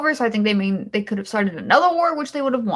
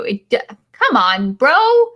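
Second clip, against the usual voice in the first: exasperated outburst; 2 semitones higher, 4 dB louder, slower than usual. Real, not acted. Reviewer fed up with the movie, yelps bro. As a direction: throw up your hands at the plot.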